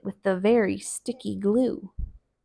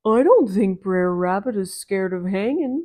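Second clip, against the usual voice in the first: bear voice. Acted. Bear doubts the rabbit's fear, different voice.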